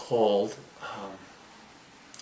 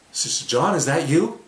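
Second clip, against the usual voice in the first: higher, louder woman's greeting, lightly voiced; acted astonished question; surprised recognition; 5 semitones higher, 7 dB louder than usual. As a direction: call out as if spotting someone long lost.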